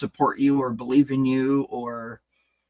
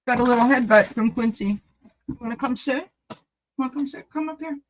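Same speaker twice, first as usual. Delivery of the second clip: pet talk; 11 semitones higher, faster than usual. Real, not acted. Inviting Quincy the cat to come sit, in high baby talk.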